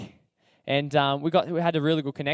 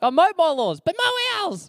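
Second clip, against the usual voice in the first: shrill mock yell; 13 semitones higher, 3 dB louder than usual. Housemate retort quoted.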